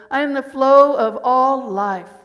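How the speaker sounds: loud, proclaimed affirmation; affirmation read aloud. Shown as a demonstration. Preacher proclaiming her affirmation loudly to the congregation. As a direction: declare it boldly to the room. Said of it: "I'm gonna read the affirmation I wrote".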